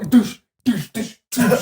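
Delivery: high, shouted punch noises; punch sound effects. Retold or imitated. Acting out boxing hits for friends, shouted punch noises.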